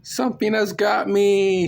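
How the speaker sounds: high loud scream of terror; terrified scream. Acted. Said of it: "she screamed".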